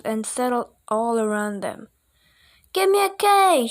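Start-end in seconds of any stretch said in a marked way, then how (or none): 2.7-3.7 high, loud cheer; cheerleader shout. A narrator's reading. Cheerleaders chanting to the crowd, loud high cheer. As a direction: call it out like a cheerleader. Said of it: "shouted the cheerleaders".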